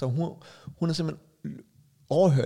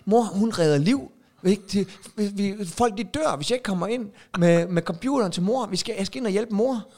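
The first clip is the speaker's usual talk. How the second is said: dramatic dad; 8 semitones higher, 4 dB louder than usual. Retold or imitated. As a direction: lay it on thick to the kids. Dad playing the doctor card.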